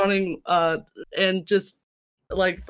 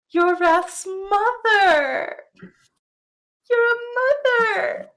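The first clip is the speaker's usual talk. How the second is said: tearful gushing; 12 semitones higher, 3 dB louder, much slower than usual. Acted. Gris gushing over Bea as mother.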